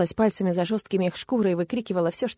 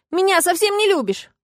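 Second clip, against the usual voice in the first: high-pitched hurt wail; tearful accusation; 10 semitones higher, 4 dB louder than usual. A narrator's reading. Woman tells dragon he doesn't love her, tearful. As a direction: lift it into an upset reproach. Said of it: "рыдая, возразила я".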